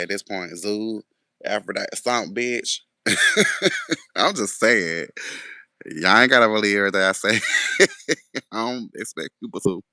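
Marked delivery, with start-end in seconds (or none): 3.0-4.1 high-pitched burst of laughter; laughing. Real, not acted. Laughs at own irreverent joke. 7.4-8.5 high giggling burst; laughing. Real, not acted. Laughs at own remark.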